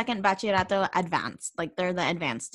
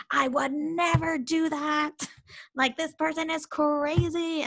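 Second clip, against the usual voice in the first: high-pitched, drawn-out mock disbelief from the kids; retold teenage outrage, high and emphatic; scandalized students; 7 semitones higher, slower than usual. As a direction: imitate students appalled at a crazy story, pitched up. Kids reacting to crazy stories.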